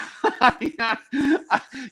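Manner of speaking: high burst of laughter; cracking up at a customer wanting credit; real laugh. Real, not acted. Laughing at own joke.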